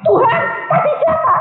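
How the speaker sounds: very high startled cry; retold shout. Preacher cries out to God on learning of deception, shouting. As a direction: re-enact crying out to God. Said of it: "Saya langsung teriak".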